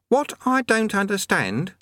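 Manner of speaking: put-on high young boy's voice; lightly high-pitched youth voice; boy Eric. Eric's line.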